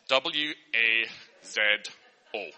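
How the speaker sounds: slow "W A Z O", letter by letter; spelling it out. Shown as a demonstration. Teacher spelling oiseau the way it sounds. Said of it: "I would say oiseau".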